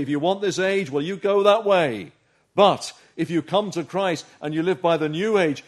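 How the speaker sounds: loud, driving sermon delivery; impassioned preaching. Real, not acted. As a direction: press it home forcefully to the congregation. Urgent preaching at full force.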